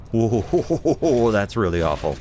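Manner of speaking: drawn-out whoa breaking into laughter; amused dismay. Real, not acted. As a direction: laugh at your own awful mistake. Laughs at own ugly colour choice.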